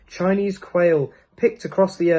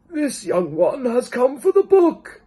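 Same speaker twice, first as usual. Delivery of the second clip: put-on second old man; 10 semitones higher, 4 dB louder than usual. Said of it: "said the old man".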